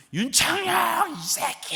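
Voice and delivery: high, loud, drawn-out yell; imitated angry yelling. Elder cursing the new pastor after worship, angry yelling. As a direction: bellow the name as an insult. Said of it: "소리 소리를 지르는 거예요".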